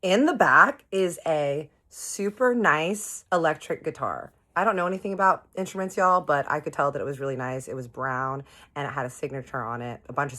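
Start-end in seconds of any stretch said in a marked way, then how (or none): none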